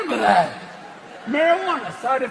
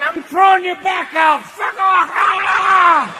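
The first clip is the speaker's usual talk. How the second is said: higher, louder raging shout; furious heart; 7 semitones higher, 5 dB louder, slower than usual. Acted. Heart screaming at the penis, furious. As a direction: scream at him to slow down. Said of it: "your heart's going".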